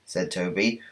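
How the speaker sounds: ordinary delivery, nothing marked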